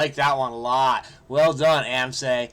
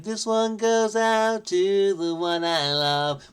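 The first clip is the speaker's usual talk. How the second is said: slow, whiny imitation of a rock singer, pitched up; 6 semitones higher, 3 dB louder, slower than usual. Solver imitating the R.E.M. singer's whiny voice while doing the crossword. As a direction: croon it like a nasal-voiced rock frontman, slowly. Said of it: "that whiny voice that I'm trying to emulate".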